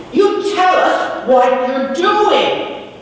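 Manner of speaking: high, loud, slow demand. A narrator's reading. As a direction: insist firmly on an answer. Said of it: "Another one demanded".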